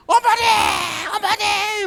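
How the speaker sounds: high, loud cry of mother; shouting for mother. Retold or imitated. Recruits crying out for their mothers, high loud shout. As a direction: call out for your mother.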